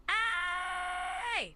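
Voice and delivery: long, high sustained cry; drawn-out emphatic call; held longer. Shown as a demonstration. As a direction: keep the vowel going on a high note. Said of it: "keep it going for longer".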